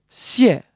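xie starting high and falling sharply. Shown as a demonstration. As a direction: drop the pitch through xie, fourth tone. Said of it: "one falling tone".

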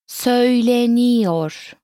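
Söyleniyor stretched syllable by syllable; slow teaching model. Shown as a demonstration. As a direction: draw out Söyleniyor slowly and evenly. Teacher models word slowly, then normally.

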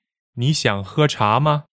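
measured Mandarin model, 吗 left toneless; neutral-tone particle. Shown as a demonstration. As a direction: demonstrate the question, letting final 吗 go light. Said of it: "ma in a sentence as a question particle".

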